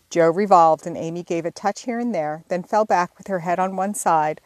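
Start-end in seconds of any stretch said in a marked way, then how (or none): none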